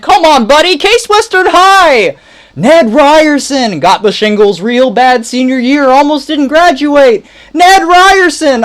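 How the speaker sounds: loud, high pushy salesman voice; Ned Ryerson. Retold or imitated. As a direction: blast it like an overeager old classmate. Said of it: "Ned the head".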